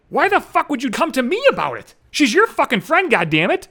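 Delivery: high, exasperated questioning; retold exasperation, high-pitched; indignant protest. Indignant rant at the chatterbox.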